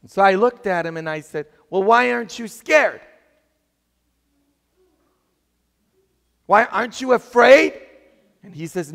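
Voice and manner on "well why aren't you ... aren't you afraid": loud, high, astonished questioning; raised voice, baffled by such calm; incredulous question. Retold or imitated. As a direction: re-enact amazed disbelief at full volume. Acts out amazed question to fearless boy.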